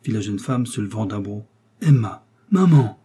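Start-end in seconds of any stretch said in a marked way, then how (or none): 1.8-3.0 Charles shouts after wife and mother; calls both names loudly to bring them back; calling out. A narrator's reading. Charles calling his fleeing wife and mother, loud cry. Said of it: "s'écriait Charles".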